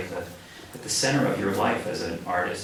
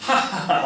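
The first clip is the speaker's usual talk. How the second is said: mock theater laugh; 10 semitones higher, 5 dB louder than usual.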